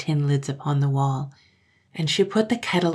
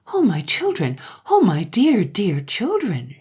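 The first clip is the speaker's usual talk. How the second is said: motherly alarm with swooping emphasis; fussing, anxious mother; concerned mother; 4 semitones higher, 5 dB louder than usual. A narrator's reading. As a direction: exclaim like a worried mother. Astonished, worried mother greets crying children.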